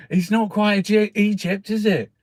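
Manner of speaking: mock scornful critic. Mocking Stonehenge critics to his mate, high sneering jeer. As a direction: jeer it like a snob.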